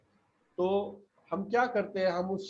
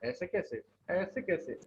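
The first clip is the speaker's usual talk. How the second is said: soft take; 7 dB quieter than usual. Shown as a demonstration. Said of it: "stressing कर रहे हैं".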